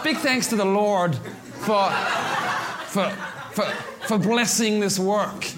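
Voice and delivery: slow, hammed-up American accent; mock American acceptance speech with halting, drawn-out words; put-on American drawl, amused. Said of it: "I just kick into America at this point".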